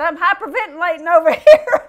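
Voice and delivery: loud laughing complaint; laughing exaggeration. Preacher retelling his own joking complaint, loud laugh. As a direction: burst out with mock panic and laughter.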